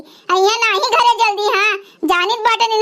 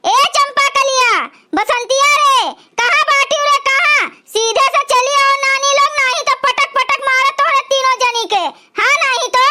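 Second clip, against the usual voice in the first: higher, calling-out scold across the house; scolding yell; 4 semitones higher than usual. Acted. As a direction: summon them with a threatening shout. Grandmother yells for daughters-in-law.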